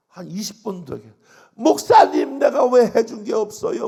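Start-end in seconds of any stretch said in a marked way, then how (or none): 1.5-3.9 much higher and louder, hitting 왜; a wailing, loud plea; crying outburst. Retold or imitated. Deaconess wailing to the pastor, loud and high. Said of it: "막 그러면서 통곡을 하고 울어".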